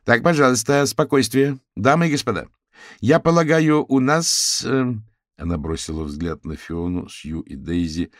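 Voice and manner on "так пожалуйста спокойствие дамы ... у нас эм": raised, higher voice addressing a hall; calming a crowd. A narrator's reading. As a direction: call the room to order. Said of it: "успокаивая толпу".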